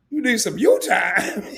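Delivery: laughing through the words. Real, not acted. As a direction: repeat it while cracking up.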